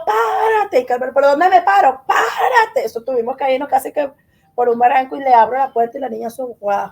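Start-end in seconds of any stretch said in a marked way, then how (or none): 0.1-2.9 high-pitched urgent calls to pull over; retold panicked cry. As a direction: act out crying out to the driver in alarm. Retold panic to stop the car.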